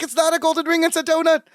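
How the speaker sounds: high-pitched retold protest; mock panicked denial. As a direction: imitate a flustered denial. Retold panicked denial before midnight.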